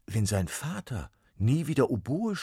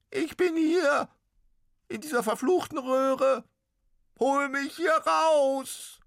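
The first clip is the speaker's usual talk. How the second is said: very high, loud squeaky ghost cry; pitiful little ghost; 9 dB louder, slower than usual. Acted. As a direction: wail it like a tiny trapped ghost. Ghost trapped in oboe.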